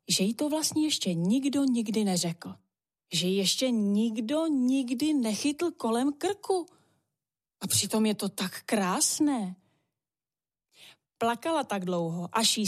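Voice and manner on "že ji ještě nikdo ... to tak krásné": ordinary delivery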